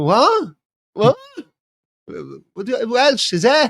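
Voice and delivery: high, loud Welsh outburst; thick Welsh. Acted. Police officer brother to someone being arrested, thick Welsh. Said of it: "you can't be arrested and you go".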